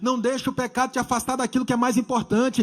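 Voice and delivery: loud, emphatic exhortation; forceful preaching. Real, not acted. Preacher urging church against sin, loud forceful preaching.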